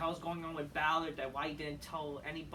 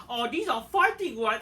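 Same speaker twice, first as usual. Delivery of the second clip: much louder mock-dramatic quote; mocking Becky; 4 semitones higher, 9 dB louder than usual. Vlogger parodying Becky's promo, loud and hammy. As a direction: ham up the tough-talk line. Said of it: "Becky's like".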